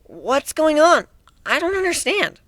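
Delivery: put-on confused young girl. Mari asks the group about Griff, confused. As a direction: ask it like a lost, bewildered girl.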